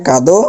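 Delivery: কাদো ending in a clear o; final o sound. Shown as a demonstration. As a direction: round the end of কাদো to ও. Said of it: "উচ্চারণটা কী হবে". (শেষে ও দিয়ে: কাদো)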